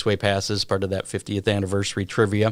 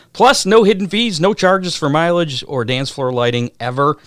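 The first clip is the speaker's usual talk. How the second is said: ad read; 2 semitones higher, 4 dB louder than usual. Acted. Reads sponsor ad.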